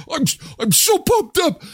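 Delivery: hyped-up frenzy; getting carried away, pumped; energy drink ad. Real, not acted. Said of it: "Okay, that's enough. Settle".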